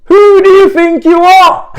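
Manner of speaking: high, loud, drawn-out taunt; incredulous bellow at the rivals; mocking shout. Real, not acted. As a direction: shout it slowly, breaking into a laugh. Mock challenge to rival fans.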